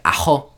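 "Ajo" said short and quick, the way they cut the word down; a clipped Puerto Rican "Ajo". Shown as a demonstration. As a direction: say "Ajo" fast, with letters dropped. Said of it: "But we would be like".

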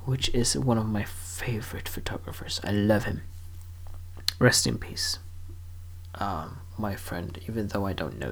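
soft whispered tribute; whispered aside. Real, not acted. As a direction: hush it like a private aside. Whispered aside about favorite photographer.

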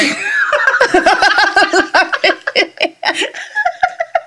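a long, high, falling laugh. Real, not acted. Laughing at own sappy remark.

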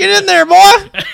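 high, hammed-up Southern drawl greeting; Southern accent impression. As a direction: play a folksy Southern hotel lady. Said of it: "That's pretty close".

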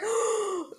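high mock gasp of dread; fearful gasp. Acting out realizing there's no toilet paper, mock fearful gasp. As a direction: suck in breath as if panicking. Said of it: "The fear".

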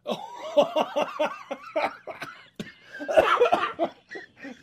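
long, high, loud laughing fit; real guffaw. Real, not acted. Host reacting to co-host's crude joke, helpless loud laughter.

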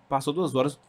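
ordinary talk, nothing marked

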